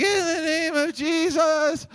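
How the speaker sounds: loud, high, stretched-out holler; shouting. Retold or imitated. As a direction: try a full-throated prayer shout, drawn out. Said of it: "maybe I'll try shouting".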